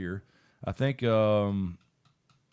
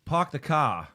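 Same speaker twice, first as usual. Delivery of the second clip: r-less Bostonian "pahk the cah", pitched up; Boston accent; 4 semitones higher, 4 dB louder than usual. Shown as a demonstration. Reading out a chat line as Bostonian, Boston accent. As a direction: drop both r's like a Bostonian. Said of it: "then Bostonian".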